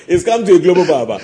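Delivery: laughing out the pun. Real, not acted. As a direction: crack up on the joke.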